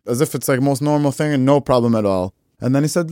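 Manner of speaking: normal talking voice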